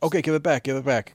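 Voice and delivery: snatch it back, pushy and loud; impatient demand. Retold or imitated. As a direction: kid demanding his game back. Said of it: "it's like".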